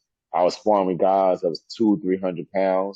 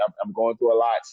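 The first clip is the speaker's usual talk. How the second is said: ordinary talk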